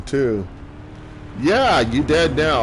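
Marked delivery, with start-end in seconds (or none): 1.4-2.6 slow, louder taunt; slow gloating. Pretend. Gamer taunting a downed enemy, slow and gloating. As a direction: drawl the taunt with relish. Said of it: "I like that one".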